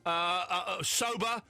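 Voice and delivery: ordinary talk, nothing marked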